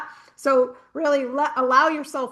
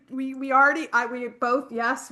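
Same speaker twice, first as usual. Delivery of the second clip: ordinary delivery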